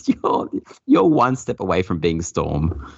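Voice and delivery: usual speaking voice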